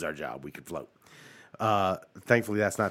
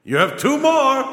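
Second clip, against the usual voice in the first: high, loud genie voice; 12 semitones higher, 5 dB louder than usual. Pretend. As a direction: announce it like a sly genie. Genie scene continues.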